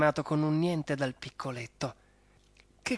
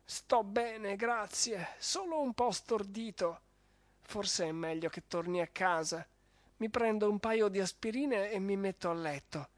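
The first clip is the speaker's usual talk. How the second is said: a hint of higher, softer baron, a bit stunned; dazed baron; 5 semitones higher, 4 dB quieter than usual. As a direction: thank them weakly, still groggy. Dazed baron after the punch.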